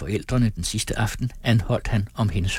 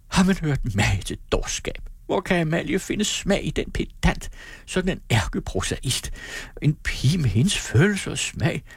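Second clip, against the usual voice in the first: high, scornful exclamations; mother outraged at her daughter's choice; indignant mother; 6 semitones higher than usual. Acted. Fru Ram udbryder. (moderen udbryder forarget med høj stemme)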